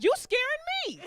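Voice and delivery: very high, squealed fright; hammed-up scared shriek; mock scared squeal. Comedian acting out being scared back, mock squeal. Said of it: "I was like".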